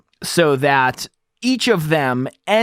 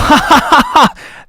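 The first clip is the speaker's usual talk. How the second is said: sudden high burst of laughter; loud laugh; 12 semitones higher, 9 dB louder than usual. Real, not acted. Laughing at himself during the gain demo, loud high laugh. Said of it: "I laugh to myself".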